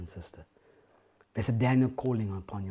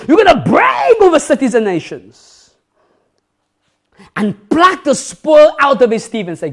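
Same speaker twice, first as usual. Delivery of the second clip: loud, high, fervent preaching; booming revival sermon voice; full-force preaching; 11 semitones higher, 19 dB louder than usual. Acted. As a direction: preach it out to the back row.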